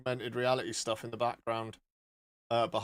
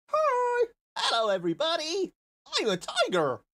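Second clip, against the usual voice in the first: squeaky sweet little tiger hello; cute tiger voice. Pretend. Streamer wearing a tiger filter greets viewers in a squeaky cute voice. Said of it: "we could be a cute tiger".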